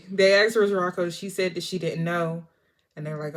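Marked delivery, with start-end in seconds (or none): none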